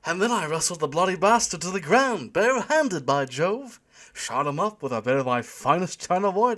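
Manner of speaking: hammed-up English accent, loud and high; thick English accent. A narrator's reading. Young man entertaining his girlfriend, mock English accent. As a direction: put on a blustering English toff's accent. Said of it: "putting on a thick English accent".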